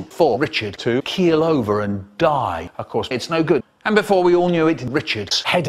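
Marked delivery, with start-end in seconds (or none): none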